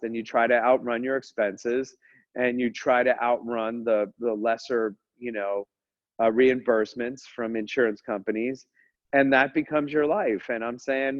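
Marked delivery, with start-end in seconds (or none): none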